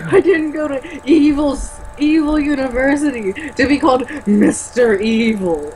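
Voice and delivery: a hint of the Doctor Evil whine; Doctor Evil impression. Quoting Doctor Evil to the co-host, lightly voiced. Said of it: "Doctor Evil".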